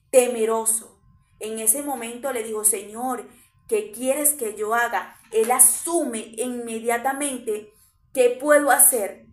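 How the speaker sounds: loud, driving sermon voice; preaching at full force. Acted. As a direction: rouse the church with every phrase. Preaching climax on Saul's repentance.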